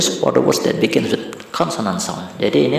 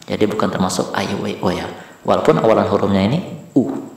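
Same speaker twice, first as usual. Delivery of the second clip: ordinary delivery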